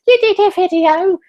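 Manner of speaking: very high, loud squeal of praise; parents' excited voice. Retold or imitated. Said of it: "that's how both of them sound".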